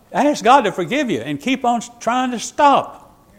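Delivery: fervent preacher's exhortation; 1 rising exhortation. Real, not acted. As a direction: press the point home with growing fervor.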